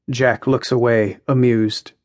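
ordinary delivery, nothing marked

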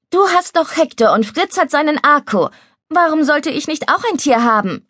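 lightly voiced stubborn little girl; young girl, insistent. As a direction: argue it like a small girl.

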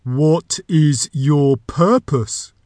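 very slow, drawn-out demand; booming door. A narrator's reading. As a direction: question the visitor as a ponderous magic door. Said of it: "the voice continued".

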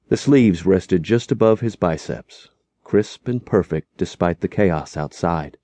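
ordinary delivery

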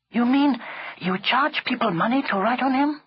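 high, incredulous question; surprised girl. A narrator's reading. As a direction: query the scheme in disbelief. Leela questions Chulak.

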